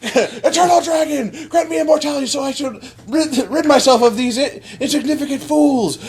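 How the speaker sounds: high, imperious cartoon villain; grandiose villain wish. Villain commanding the Eternal Dragon, grandiose high voice. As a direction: proclaim it high and grand, amused.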